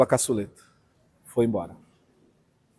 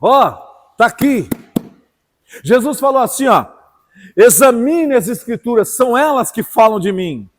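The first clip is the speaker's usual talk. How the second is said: rising, forceful pulpit voice; impassioned preacher to the congregation; fiery preaching; 6 semitones higher, 5 dB louder than usual. Acted. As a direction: build the sermon up and drive it home.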